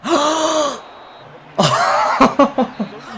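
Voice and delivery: a loud, high burst of gasping laughter. Real, not acted. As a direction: crack up at the opponent's blunder. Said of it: "I was just laughing at their fail".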